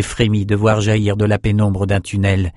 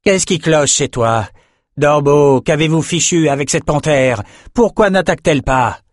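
raised, higher-pitched irate questions; bellowing anger; 6 semitones higher, 3 dB louder than usual. A narrator's reading. Adessa to trainers whose panther won't attack, bellowing. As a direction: berate the handlers sharply. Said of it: "beugla Adessa".

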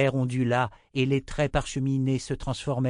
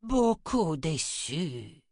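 very slow, high crone voice; old woman; 6 semitones higher, much slower than usual. A narrator's reading. Old mother rebuking her daughter, slow reproach. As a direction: draw out the disappointment. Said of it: "dit la vieille femme".

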